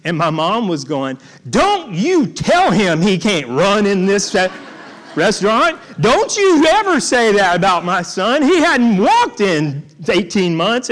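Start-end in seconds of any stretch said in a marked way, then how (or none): 1.5-10.9 louder, fierce protective mother; mom scolding. Retold or imitated. Mother defending her son to restaurant diners, fierce and louder. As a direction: snap back at the diners for your son.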